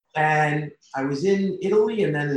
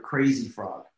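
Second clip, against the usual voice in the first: ordinary delivery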